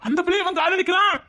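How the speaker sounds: high, aggrieved cry; outraged, voice shooting up; indignant complaint. Acted. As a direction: protest the injustice at a high pitch. Complains about rival.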